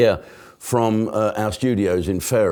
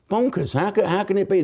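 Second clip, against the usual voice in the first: mock disbelief; 10 semitones higher than usual. Mock outrage at Biden.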